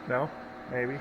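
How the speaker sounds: usual speaking voice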